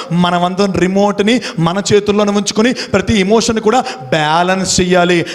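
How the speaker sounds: ordinary delivery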